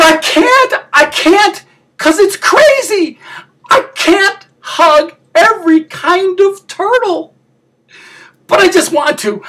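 high-pitched, hammed-up crying; tearful wail. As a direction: wail it way up high, on the verge of sobbing. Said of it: "I promised myself I wouldn't cry".

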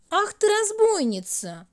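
high, loud mock scolding. A narrator's reading. Hour scolds the hiding minute, high and loud. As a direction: tell her off, playfully cross. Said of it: "напустился на неё пятый час".

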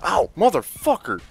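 high, loud curse at being hit; pained outburst. Real, not acted. Player just hit by an enemy, pained outburst. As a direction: curse loudly at the blow.

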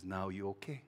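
low, very soft check-in; tender father. Acted. Father holding his worn-out child, tender and hushed. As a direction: ask it gently and quietly.

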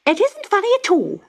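high, fast, indignant bird voice; magpie. A narrator's reading. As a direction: snap it like a cross magpie. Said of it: "said the magpie".

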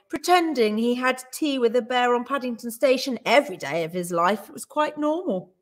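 ordinary delivery, nothing marked

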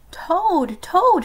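high, loud calling out; shouting. A narrator's reading. Frog calls at sleeping Toad's door, shouting. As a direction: shout it out to wake someone. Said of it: "shouted Frog".

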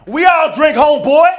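high, loud heckler talking back. Retold or imitated. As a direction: snap it back like a rowdy guy at the back. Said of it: "This dude in the back was like".

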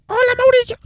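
very high-pitched cartoon greeting; imitating a high cartoon voice; Simpsons character. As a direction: squeal out a cartoon hello. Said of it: "de Los Simpsons".

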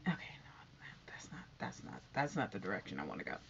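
sudden hushed whisper to herself; whispered aside. Real, not acted. Whispering to herself to change the subject, hushed. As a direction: drop to a whisper, correcting herself. Catching herself mid-thought.

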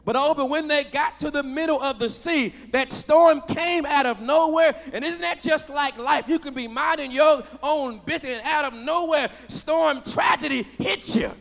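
sermon delivery building in intensity; impassioned preaching. Real, not acted. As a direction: preach it with rising force, punching key words. Preaches at raised pitch and volume.

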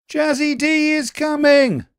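high, loud panicky call; urgent rabbits crying out. A narrator's reading. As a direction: shout the warning up the tree in a panic. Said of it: "they cried".

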